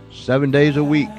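usual speaking voice

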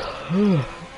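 drawn-out tired yawn; big yawn. Real, not acted. Tired commentator yawning during the race, yawn. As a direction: yawn out a long breath. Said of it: "I apologise for yawning so much".

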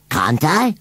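high-pitched defiant retort. A narrator's reading. Scarecrow challenges Susan over keeping baby, defiant. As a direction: throw it back as a challenge. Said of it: "said Gummidge defiantly".

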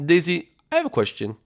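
ordinary delivery

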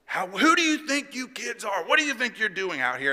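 indignant imitation of a cop telling kids off; retold angry yelling. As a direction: scold like a mad cop, without a full yell. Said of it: "he is yelling".

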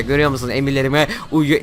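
ordinary delivery

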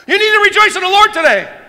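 very high, very loud cheerful yell; imitating Bobby yelling. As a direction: yell the blessing at a churchgoer. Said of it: "I just hurt my own ear yelling".

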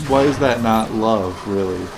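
ordinary talk, nothing marked

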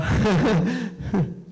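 put-on high jeering kid laugh; high taunting giggle; mocking laugh. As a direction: laugh at him like a bully. Brother laughing at him.

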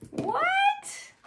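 very high squeal of disbelief; shocked. A narrator's reading. Animals hear the boastful claim, high-pitched shock.

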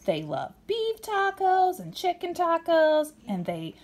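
lively picture-book read-aloud; reading to children. A narrator's reading. Parent reading a storybook to a child, lively. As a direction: read it brightly to a little kid.

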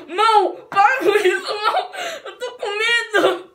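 giggling protest at the hand; laughing panic. Real, not acted. Hand pushing food at her.

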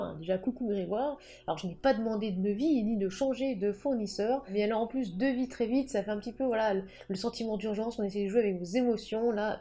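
usual speaking voice

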